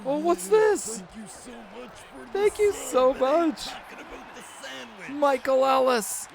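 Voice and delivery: high-pitched, drawn-out thank-you; excited high announcer squeal; excited shout-out. Real, not acted. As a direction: squeal out a thrilled thanks to a new subscriber. Excitedly thanking a new subscriber mid-game.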